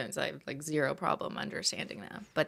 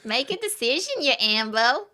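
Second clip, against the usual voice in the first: loud, higher mock Australian; put-on Aussie accent; 8 semitones higher, 6 dB louder than usual. Quiz player testing a slang word in a sentence, mock Australian accent. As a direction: call it out like a hammed-up Aussie.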